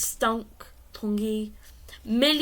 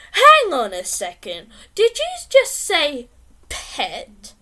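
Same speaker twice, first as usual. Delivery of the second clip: suspicious mum; 3 semitones higher, 9 dB louder, slower than usual. Acted. Mum catches the word pet.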